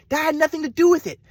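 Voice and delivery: usual speaking voice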